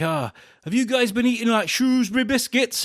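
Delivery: Ross Noble. Retold or imitated. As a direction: call it to the crowd, lightly impersonating. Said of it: "he mentioned, he was like".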